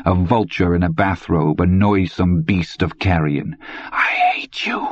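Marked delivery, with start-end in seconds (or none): 3.9-4.9 breathy whisper of hate; boy whispering hatred at the old man. A narrator's reading. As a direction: hiss it under your breath. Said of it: "he whispered".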